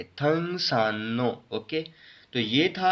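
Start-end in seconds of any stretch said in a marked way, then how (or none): 0.0-1.4 थंगसानो slowly, with a held N; R becomes N. Shown as a demonstration. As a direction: draw out the न in थंगसानो. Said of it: "पढ़ेंगे". (थंगसानो, र की जगह लंबा न)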